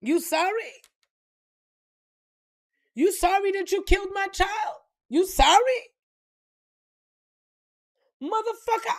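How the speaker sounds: high, loud incredulous challenge; outraged disbelief. Acted. Parent confronting the child's killer, high-pitched outrage. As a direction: throw the apology back, voice climbing in outrage.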